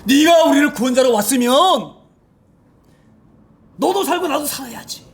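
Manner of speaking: high mocking demand; jeering thief. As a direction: taunt him as a scared killer would. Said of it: "욕지를 퍼붓는 거예요".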